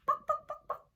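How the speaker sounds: high clucking bok bok; chicken clucking. Shown as a demonstration. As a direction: cluck like a hen. Imitating a chicken.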